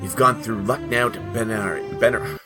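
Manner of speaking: normal talking voice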